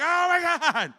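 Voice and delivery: high, very loud wail; agonized cry. Retold or imitated. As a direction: cry out in agony. Agony continues.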